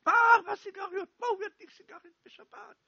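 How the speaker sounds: father yelling in outrage at the smoker, high and fast. Retold or imitated. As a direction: shriek it in disbelief as the angry father. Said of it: "פתאום אבא שלי התחיל לצעוק".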